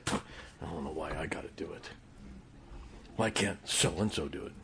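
fast, breathy grumble under the breath; sulky teen mutter. Shown as a demonstration. Preacher imitating a teen grumbling at mom's orders, whispered. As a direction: mutter it like a sulky teenager told to do chores. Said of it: "this is what you say".

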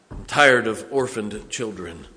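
ordinary talk

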